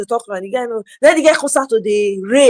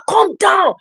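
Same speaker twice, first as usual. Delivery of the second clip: "Calm down" thrown out high and sharp; high mock yell; 10 semitones higher than usual. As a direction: yell "Calm down" at the rude driver. Father confronting the driver.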